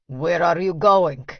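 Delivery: agitated Russian demanding, slow and heavy; thick Russian accent, tired and agitated. A narrator's reading. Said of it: "his accent is thick".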